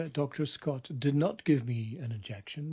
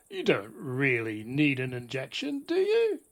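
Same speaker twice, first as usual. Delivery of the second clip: booming, scornful dentist voice; loud, overbearing; 7 dB louder than usual. Retold or imitated. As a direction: talk down to a scared kid, loud and sneering. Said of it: "declared in a loud, overbearing voice".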